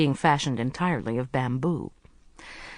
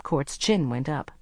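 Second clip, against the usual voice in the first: plain reading voice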